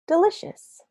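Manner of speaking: high and loud, savouring the snack; a giant relishing a treat; loud, high gleeful giant; giant's voice. Retold or imitated. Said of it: "Fresh sunflower seeds".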